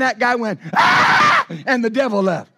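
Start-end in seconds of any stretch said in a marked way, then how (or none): 0.7-1.5 sudden piercing yell; sharp shout. Acted. Preacher cries out at the devil with a piercing yell.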